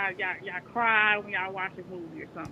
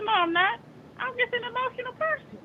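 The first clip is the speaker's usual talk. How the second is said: much higher, insisting she's not a punk; defensive protest; 13 semitones higher than usual. Retold or imitated. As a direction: protest high and a little laughing. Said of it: "I said".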